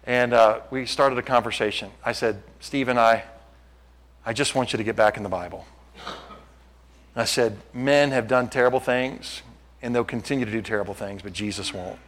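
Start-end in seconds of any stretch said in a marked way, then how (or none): 2.6-12.0 softer, lower, confiding tone; gentle appeal. Retold or imitated. Preacher retells his appeal to hurt young Steve, gentle. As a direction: appeal quietly and low, as if one-to-one.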